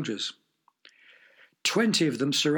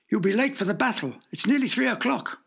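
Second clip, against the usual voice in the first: anxious servant; 7 semitones higher than usual. Acted. Servant warns of lateness.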